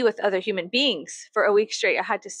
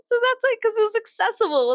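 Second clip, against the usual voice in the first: high, louder excited defence; defensive squeal; 13 semitones higher, 4 dB louder than usual. Real, not acted. Vlogger defends why she grabbed the mask, squealing higher. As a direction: justify yourself in a rush.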